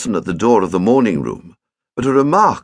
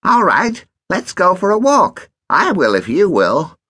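higher, louder bluff-calling; cheeky boy; 10 semitones higher, 4 dB louder than usual. A narrator's reading. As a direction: dare him sweetly.

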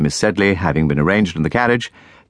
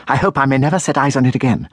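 higher and fast, mild furious rush; in a fury; 6 semitones higher, faster than usual. A narrator's reading. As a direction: rattle off the angry vow. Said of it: "continued Miss Sharp in a fury".

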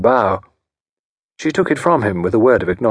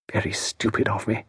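much softer, under her breath; sotto voce; 8 dB quieter than usual. Acted. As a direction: say it aside so he won't hear. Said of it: "sotto voce".

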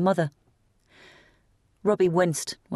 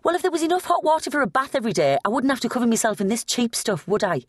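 high, fast, biting retort; snapping; 8 semitones higher, faster than usual. A narrator's reading. Teen snapping back at her sister, high and fast. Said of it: "before snapping".

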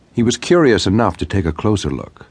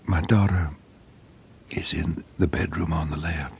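softer and softer, breath nearly gone; fading to almost nothing; 8 dB quieter than usual. A narrator's reading. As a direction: let the voice die away as the breath goes. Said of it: "She was speaking even more softly now".

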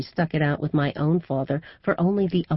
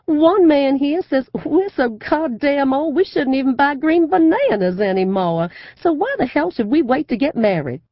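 gushing southern belle; 11 semitones higher, 8 dB louder than usual. A narrator's reading. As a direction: play the Southern belle for the crowd. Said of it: "breaks out in a peal of laughter".

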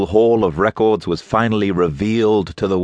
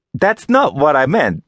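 put-on young woman voice; 10 semitones higher, 4 dB louder than usual. A narrator's reading.

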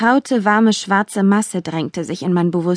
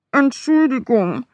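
slow, higher muffled apology; muffled murmur; 4 semitones higher than usual. A narrator's reading. Demon dog apologizing from under mistress's hands, muffled murmur. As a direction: mumble as if a hand covers your muzzle. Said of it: "murmelte der Dämon unter meinen Händen".

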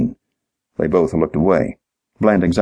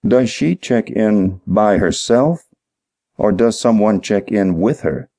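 slowly, higher, each word set apart; slow, deliberate; 4 semitones higher than usual. Acted. Detective questions dim-witted clerk, slow and deliberate. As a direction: let every word sink in for a slow listener. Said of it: "Dean said slowly, letting each word sink".

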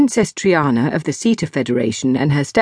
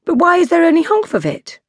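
Zoe pointed; 12 semitones higher than usual. Acted. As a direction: push back, puzzled and pointed. Zoe voiced.

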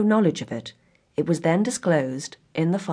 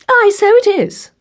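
high, loud, drawn-out exclamation; crying out; 9 dB louder than usual. A narrator's reading. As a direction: cry it out slowly, pitched way up. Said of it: "cried her mother".

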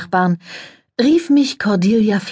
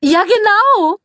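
very high, sugary society voice; gushing press lady; 4 dB louder, slower than usual. A narrator's reading. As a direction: coo it, all air kisses and fuss. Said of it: "sagte Flack eilig".

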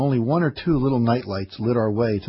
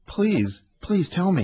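pleading, pitched higher; begging; 7 semitones higher than usual. A narrator's reading. Old man begging the narrator for news of Hannah, pleading higher. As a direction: beg the old man to tell you, voice lifting. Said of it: "he begged".

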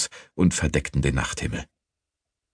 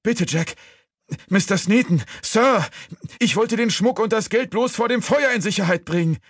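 desperate pleading. A narrator's reading. Accused thief swearing innocence to Jack, desperate and high.

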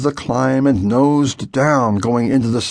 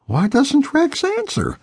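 much higher, puzzled sleepy question; boy wondering in a dream, much higher; dreamy wondering. A narrator's reading. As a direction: ask it like a half-asleep boy.

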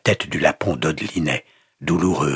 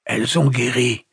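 strained, higher, effortful words; rasped out; 7 semitones higher, 4 dB louder than usual. A narrator's reading. Wounded captive answering the pastor, rasping with effort. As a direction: force it out like a groan. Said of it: "souffla-t-il dans un râle".

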